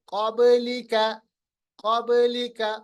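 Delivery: क़ब्लिका with a bounce after ब; qalqalah demo. Shown as a demonstration. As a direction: echo the ब in क़ब्लिका. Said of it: "क़ब नहीं". (क़ब्लिका में बा का क़लक़ला)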